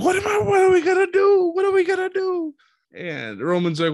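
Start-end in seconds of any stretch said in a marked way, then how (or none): 0.0-2.5 high, loud frantic squeal, much faster; shrieking in a hammed-up panic; mock panic.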